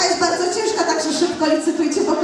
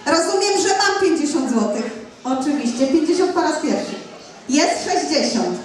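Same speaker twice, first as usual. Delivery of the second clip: brisk auctioneer calling bids. Acted. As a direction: rattle off the bids.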